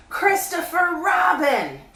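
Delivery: high, loud bear calling down; Pooh voice. A narrator's reading. Pooh calling down to Christopher Robin, high bear voice. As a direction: call up from the honey tree like a bear.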